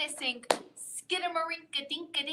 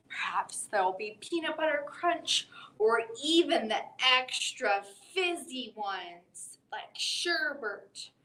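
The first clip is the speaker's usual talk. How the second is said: usual speaking voice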